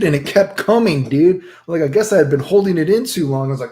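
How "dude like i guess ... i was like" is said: ordinary delivery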